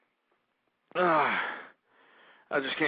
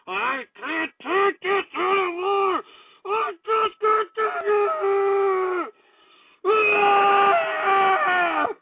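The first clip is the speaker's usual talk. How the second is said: slow, high, howled with rage and despair; wailing furious cry, stretched out; anguished screaming; 7 dB louder, much slower than usual. Acted. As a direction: scream it out like you're cracking. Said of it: "I'm just getting so damn angry".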